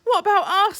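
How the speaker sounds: high, loud protest; a waiter crying out, hard done by; complaining waiter. Acted. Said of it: "said a waiter".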